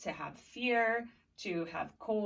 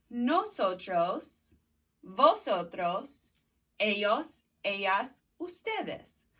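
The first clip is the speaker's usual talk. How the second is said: loud, slow call-and-repeat drill; drilling the pronouns aloud; 3 dB louder, slower than usual. Shown as a demonstration. Teacher leading students in a loud repeat-after-me drill. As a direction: chant each pronoun for the class to echo. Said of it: "Do it with me".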